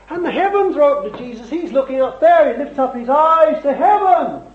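high, excited sermon delivery; fervent preaching. Real, not acted. Preacher telling of Jesus raising Lazarus, fervent preaching. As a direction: preach with rising fervour.

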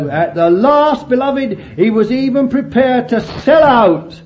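loud, high, hammering preaching; fervent preaching. Acted. Preacher urging the congregation, fervent and loud. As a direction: drive it home from the pulpit.

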